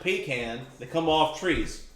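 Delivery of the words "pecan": "pecan" as two clear parts, PEE-can; pronunciation demo. Shown as a demonstration. Player correcting the table on how it's said, pronunciation demo. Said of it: "It's pronounced".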